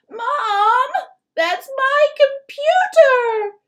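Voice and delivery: put-on a boy shrieking in terror for his computer; high-pitched terror. A narrator's reading. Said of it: "His voice has gone all high-pitched with terror".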